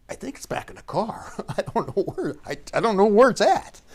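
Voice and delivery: laughing through the words, high and loud; cracking up while answering; real laughter breaking through the answer. Real, not acted. Retelling absurd answer to trooper.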